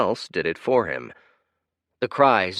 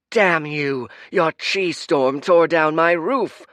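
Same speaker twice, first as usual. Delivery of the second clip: loud, higher, slow furious yell; angry shout; 8 semitones higher, 8 dB louder, slower than usual. Acted. Angry villager cursing the hero, loud and furious. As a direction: curse the hero for your roof.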